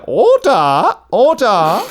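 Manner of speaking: high, loud cry of order; a pretend parliamentary Speaker calling the house to order; [name] as Speaker. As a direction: bellow for order like a Commons speaker. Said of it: "[name] as the speaker".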